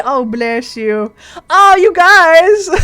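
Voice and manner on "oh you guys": bashful laugh, drawn out slowly. Real, not acted. Flattered by chat's compliment, laughing slowly.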